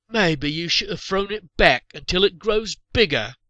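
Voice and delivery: loud, high, slow mocking call; a second joker's drawn-out taunting shout. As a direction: sing it out across the fire to needle them. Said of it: "someone else called".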